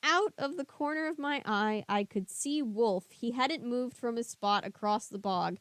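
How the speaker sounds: ordinary delivery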